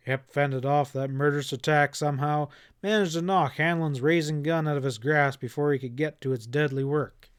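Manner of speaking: plain reading voice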